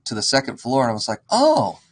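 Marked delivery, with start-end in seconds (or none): 1.3-1.8 mock surprise. Sees hole in roof.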